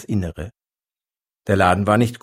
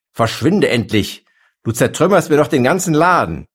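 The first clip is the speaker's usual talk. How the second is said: loud outburst rising in pitch; furious; 5 semitones higher, 5 dB louder than usual. A narrator's reading. Shopkeeper shoving the elephant out with a broom, furious. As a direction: build from sharp order to high, loud outrage.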